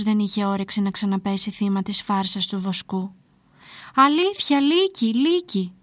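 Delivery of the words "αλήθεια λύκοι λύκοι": high, pleading cry; tearful shouting. A narrator's reading. Shepherd insisting to villagers the wolves are real, pleading shout. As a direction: plead to be believed, a touch tearful. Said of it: "φωνάζει βραχνιασμένος και κλαίγοντας".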